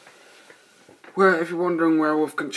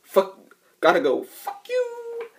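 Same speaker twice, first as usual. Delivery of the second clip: much higher and louder quick brush-off; hanging up on the ex-wife in a high yelp; hurried mock outburst; 4 dB louder than usual. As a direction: blurt it as you rush off the phone.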